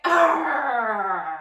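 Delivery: loud fierce pirate arr; pirate growl. Acted. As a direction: roar it out like a pirate on deck. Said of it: "I hope you sound really fierce".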